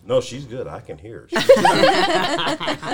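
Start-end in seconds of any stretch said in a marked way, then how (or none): 1.4-2.9 high squeal through laughter; laughing. Retold or imitated. Laughing imitation of Ashley.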